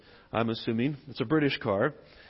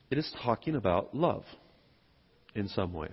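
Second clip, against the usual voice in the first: normal talking voice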